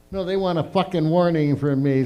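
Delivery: usual speaking voice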